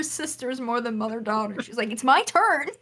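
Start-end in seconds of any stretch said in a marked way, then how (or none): 1.9-2.7 pitched-up sisterly whine; higher mock demand for a turn; imitating insistent mom. Mom to daughter over the game, retold childish insistence.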